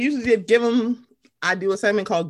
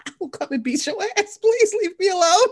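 mock Whitney threat; 10 semitones higher than usual. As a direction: play Whitney warning Wendy off, getting higher. Voicing Whitney threatening Wendy.

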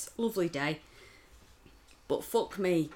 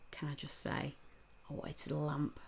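hushed confession; 4 semitones lower, 12 dB quieter than usual. Real, not acted. Confiding anger at the man.

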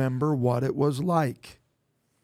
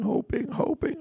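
pleading high; 11 semitones higher than usual. Real, not acted. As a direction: stretch "hoping" up high and pleading. Repeating hoping with growing emphasis.

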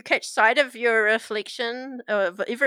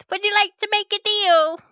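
high, loud tempting offer; sly little minion offering a deal; high sidekick pitch; Steve's voice; 12 semitones higher, 7 dB louder than usual. Acted. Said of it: "he goes".

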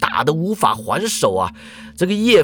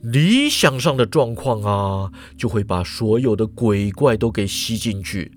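explaining professor; 6 semitones lower than usual. A narrator's reading. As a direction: lecture it low and unhurried. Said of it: "严教授就解释啦".

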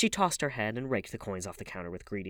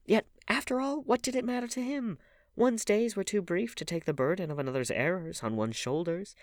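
much higher, defiant narration; 11 semitones higher, 5 dB louder than usual. A narrator's reading. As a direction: lift the voice, shrug it off. Dorian's defiant inner thought.

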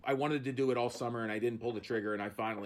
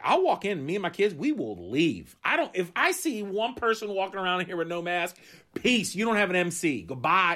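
firm ultimatum; 5 semitones higher, 5 dB louder than usual. Retold or imitated. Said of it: "I was like".

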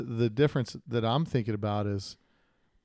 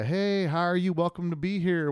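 mock friendly greeting; 6 semitones higher than usual. Imagined friendly greeting at shop.

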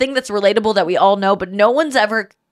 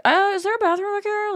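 mimicking friend; 12 semitones higher, 3 dB quieter than usual. Mimicking friend's timid question.

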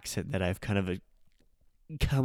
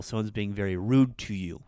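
ordinary talk, nothing marked

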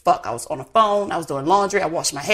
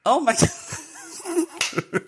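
laughing; 6 semitones higher, 4 dB quieter, much slower than usual. Real, not acted. Laughing at crude joke.

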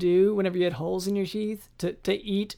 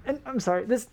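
ordinary delivery

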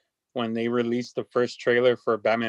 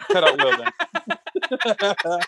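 laughing; much slower than usual. Real, not acted. Laughing mid-argument.

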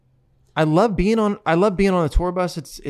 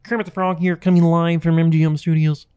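louder puppet reporter voice; playful frog impression, building louder; Kermit the Frog; 6 dB louder than usual. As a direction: announce it as a puppet on live TV. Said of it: "so that you could do that".

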